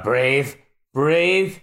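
ordinary talk, nothing marked

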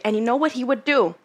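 normal talking voice

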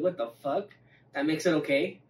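ordinary delivery

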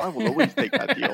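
high-pitched, overeager offer; eager volunteering. Pretend. Jumps at the joking deal.

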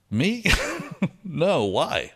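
high, incredulous mock surprise; surprised, retold. Patient answers her football question, high mock surprise.

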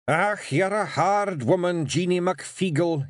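grudging surrender; Rob giving in. Acted. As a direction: Rob conceding to his wife. Rob's voice.

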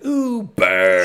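loud, high movie comeback; mock burn. Quoting the film heroine's comeback, loud and mocking. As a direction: belt out the comeback. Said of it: "And she goes".

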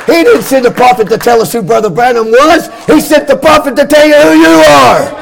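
chanting sermon climax, voice ringing over the organ; full-force preaching. Acted. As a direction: whoop it up like a revival preacher.

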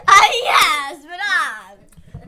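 big, loud mock Italian; theatrical fake Italian cheer; put-on Italian accent. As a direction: burst out in a hammed-up Italian accent. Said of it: "now we're using the Italian accent".

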